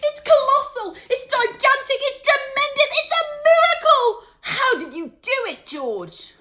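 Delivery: dancing round, overjoyed; ecstatic shouting. A narrator's reading. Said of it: "Mister Cranky shouted".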